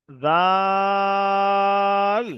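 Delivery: জাল held very long, vowel stretched; drawn-out zal. Shown as a demonstration. Teacher drawing out জাল for Arabic letter learners. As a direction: stretch জাল slowly like a recitation. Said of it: "দুটো অক্ষর একই রকম দেখতে".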